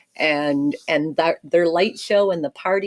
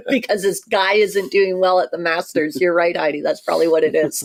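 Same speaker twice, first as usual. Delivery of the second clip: ordinary talk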